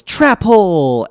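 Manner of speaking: loud, high, drawn-out shout; hammed-up attack call; mock yell. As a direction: yell the card's name, stretched out. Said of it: "and he yells".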